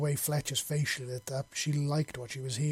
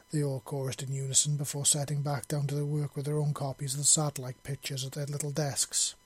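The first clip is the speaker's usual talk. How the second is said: ordinary delivery, nothing marked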